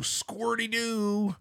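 high, drawn-out silly squeal; gleeful squeal. Real, not acted. Gleeful exclamation at hoof video.

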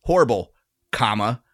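horrible with a falling end, then comma said rising. Shown as a demonstration. Host spells out the pause in the one-star review. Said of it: "Put a comma".

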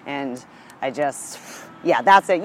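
usual speaking voice

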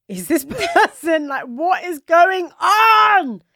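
high, slow, incredulous and laughing; bewildered disbelief. Retold or imitated. Co-host retelling her shock at her friend's crush, bewildered. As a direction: stretch out the disbelief. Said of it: "I'm staring at her like".

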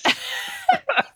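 high giggling laugh; burst of laughter. Real, not acted. Host cracks up at story.

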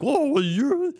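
high-pitched mock livestreamer hype. Mocking streamers who bother people in public, high-pitched whoa. Mimics loud livestreamers walking around.

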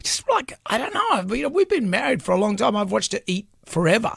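high-pitched, baffled griping; worked-up squeak of disbelief; exasperated. Real, not acted. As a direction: complain in a high, incredulous voice. Exasperated story about wife's eating.

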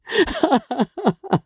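high, short burst of laughter; sudden bitter laugh. Acted. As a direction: let a bitter laugh break out. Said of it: "broke out with a sudden laugh".

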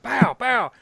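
high, punchy "pow" sound effects; imitated punch noises. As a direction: act out two whacks with the voice. Imitating blows with the head.

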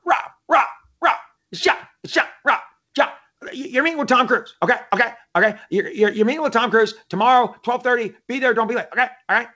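high-pitched fast-talking agent; John Levin impression. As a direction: imitate a Hollywood agent in rapid, high bursts. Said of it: "This is how he talks".

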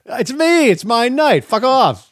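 playful diva at his own wedding; self-centred groom. Pretend. Groom waving off guests at his wedding, hammed-up entitlement.

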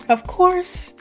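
usual speaking voice

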